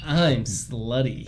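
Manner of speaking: deadpan reading. Acted. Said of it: "you read Caden".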